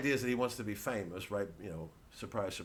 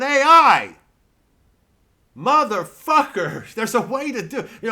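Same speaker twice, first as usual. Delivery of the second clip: slow, much higher and louder swearing outburst; furious shout; 12 semitones higher, 15 dB louder than usual. Real, not acted. Comedian raging at AI comedy, slow furious shout. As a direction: bellow it out in disgust. Ranting about AI replacing comedy.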